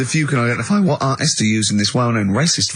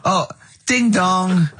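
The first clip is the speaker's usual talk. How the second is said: comedy character voice; 6 semitones higher, much slower than usual. Acted.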